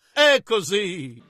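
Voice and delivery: normal talking voice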